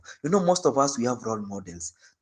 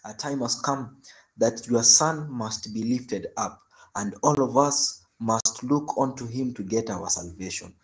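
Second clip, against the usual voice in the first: prayer; 4 semitones lower than usual. Acted. Closing prayer.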